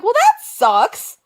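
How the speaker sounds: high, slow whiny disappointment; mock disappointment. Complaining to Noah about the playlist, high whiny letdown. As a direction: draw it out like a let-down kid.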